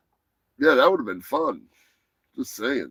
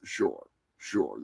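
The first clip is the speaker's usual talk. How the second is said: sarcastic sure; 12 semitones lower, 10 dB quieter than usual. Real, not acted. Sarcastic reply.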